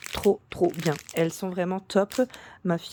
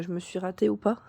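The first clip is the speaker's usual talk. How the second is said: usual speaking voice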